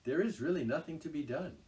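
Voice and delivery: matter-of-fact explaining; sensible Rat. A narrator's reading. Rat explains Toad's craze to Mole.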